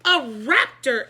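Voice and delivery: loud, slow, shocked cry; exclaimed. A narrator's reading. Board president exclaiming at the raptor, loud and slow. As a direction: blurt it out in alarm. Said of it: "exclaimed Doctor Carble".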